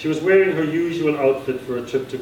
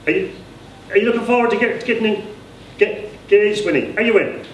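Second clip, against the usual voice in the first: high, stammering and halting voice; a stammering granduncle, eager and excited; stammering simple man; 9 semitones higher, 3 dB louder than usual. Acted. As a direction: stumble over the words, voice pitched up. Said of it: "I gave him a stammer".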